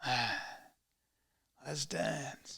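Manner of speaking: very soft, low catchphrase; sigh, then murmur it low; mock action hero. Hero before a fight, hushed mock-cool voice. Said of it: "And I go like this".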